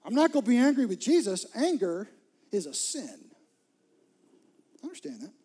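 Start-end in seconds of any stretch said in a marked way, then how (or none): 0.0-3.3 loud, high mock protest; a hammed-up shout of refusal; mocking objector. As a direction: play the indignant churchgoer refusing. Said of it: "some of you are like".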